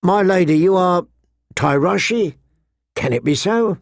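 taken aback, feel your way to the question; astonished merchant. A narrator's reading. Merchant amazed to hear the lady speak his tongue, astonished. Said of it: "he gaped at her in astonishment".

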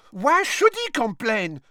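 high, loud burst of protest; indignant outburst. A narrator's reading. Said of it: "burst out Fleur Delacour, stamping her foot".

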